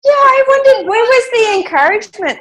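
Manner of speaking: high, loud exasperated cry; indignant outburst. Real, not acted. Co-host erupting over the judge's missing encouragement, loud and high. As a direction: burst out in protest.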